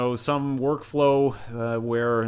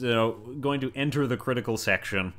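usual speaking voice

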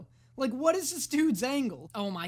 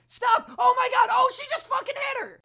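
shrieking, much higher and louder; real shocked shout; 13 semitones higher, 7 dB louder than usual. Real, not acted. Viewer screams at the girl hitting her, shocked shout. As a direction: yell at the screen in disbelief.

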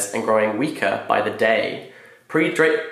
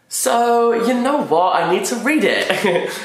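excited; 11 semitones higher, 3 dB louder, slower than usual. Real, not acted. Excited decision to read.

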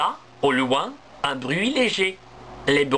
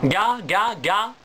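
mock goose honking, a touch higher; goose honks; 5 semitones higher, slower than usual. Geese call from the blue sky, a mock honk.

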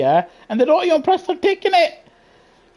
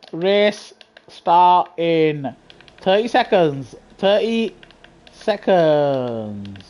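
announcer drawl; 6 semitones lower, much slower than usual. Acted. Announcing race start to lobby.

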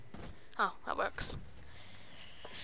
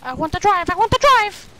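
rising from eager to high and loud; insist, louder the second time; excited demanding; 5 semitones higher, 6 dB louder, faster than usual. Real, not acted. Gamer wanting the wheel, mounting excited demand. Gamer begs to drive, building.

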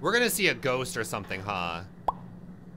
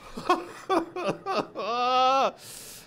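high, stuttering gasps of shock; long, high startled gasp; frightened gasping; 10 semitones higher than usual. Real, not acted. Big jump scare.

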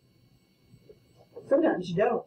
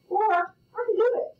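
teasing; 12 semitones higher, faster than usual. Acted. Teasing student, high fast.

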